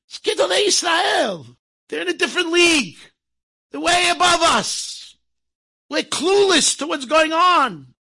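high, loud and slow proclamation; preaching emphasis. Real, not acted. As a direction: hammer home the awe of the great sages. Preacher drives home the moral.

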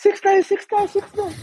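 very high, thin fan squeal; imitated crowd clamour. Whole airport rushing the rapper, high imitated clamour. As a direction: mimic a crowd mobbing a star, without real volume. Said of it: "hela flyget flyger på honom".

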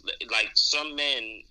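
ordinary talk, nothing marked

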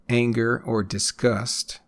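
ordinary talk, nothing marked

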